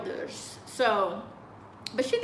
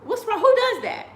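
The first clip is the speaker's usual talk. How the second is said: who-does-that outrage, climbing higher; incredulous; 7 semitones higher than usual. Retold or imitated.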